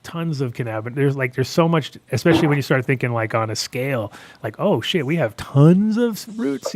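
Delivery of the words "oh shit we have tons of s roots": surprised. Pretend. Imagined realization about roots.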